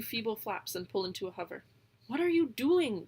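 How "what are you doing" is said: loud high alarmed yell; mild alarmed raven yell. A narrator's reading. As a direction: shout down at him in alarm. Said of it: "Gonzo yells".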